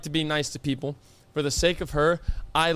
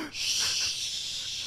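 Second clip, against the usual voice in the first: soft unvoiced shh, doubling down; a hushed drawn-out shh; firmer shush; 10 dB quieter than usual. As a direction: shush him again, insistently. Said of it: "She doubles down".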